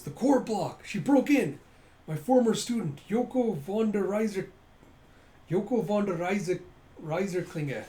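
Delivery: high, loud minion blurting news; frantic henchman, easing at the end; alarmed report. Acted. Servant blurts alarming news to master.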